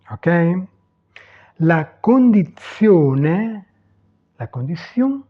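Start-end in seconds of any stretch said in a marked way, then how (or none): 1.6-3.6 "La condizione" very slow, syllable by syllable; Italian pronunciation demo. Shown as a demonstration. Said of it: "prononcer les mots". (prononcer « La condizione » très lentement, à l'italienne)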